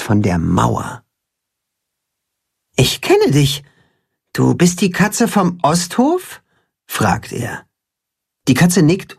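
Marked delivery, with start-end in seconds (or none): 2.8-6.4 put-on lightly raised, curious young voice; a small hen wondering who this cat is; young chicken Timme. A narrator's reading. Timme recognising the cat, curious and higher.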